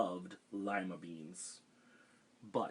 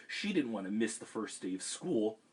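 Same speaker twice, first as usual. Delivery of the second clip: ordinary delivery, nothing marked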